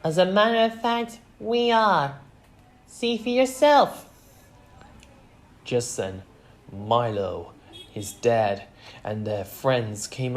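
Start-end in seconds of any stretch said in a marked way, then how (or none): 0.0-4.1 put-on high, loud, assertive girl; Velma. A narrator's reading. Daughter answering the reporters, high and loud. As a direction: declare it high and proud. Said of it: "But Velma said".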